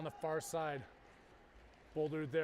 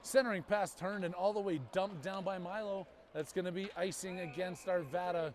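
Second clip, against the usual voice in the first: ordinary talk, nothing marked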